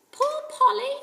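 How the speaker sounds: high, small, sad squawk; a pitiful parrot begging at the door. A narrator's reading. Kiki the parrot outside the door, small and sad. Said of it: "said a small, sad voice".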